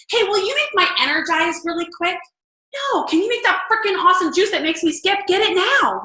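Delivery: high, gushing mock product plug; fake ad voice. Mocking salesy posts to her husband, high gushing pitch. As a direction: oversell it like a cheesy commercial. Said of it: "be real about your post".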